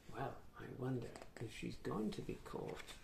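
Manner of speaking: soft low wondering aside; hushed aside. A narrator's reading. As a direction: wonder aloud under your breath.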